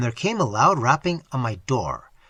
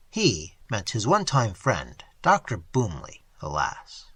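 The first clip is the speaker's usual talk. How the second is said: usual speaking voice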